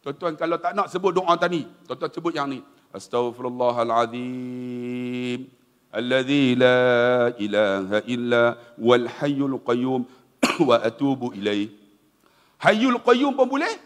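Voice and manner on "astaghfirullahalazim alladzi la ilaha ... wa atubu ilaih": slow, low chanted istighfar; intoned Arabic recitation, much lower and slowed; prayer recitation. Shown as a demonstration. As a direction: draw out each word of the prayer reverently.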